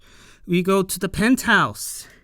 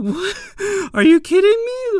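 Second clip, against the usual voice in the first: shocked shriek; 6 dB louder, faster than usual. Retold or imitated. Gota's shocked reaction, imitated.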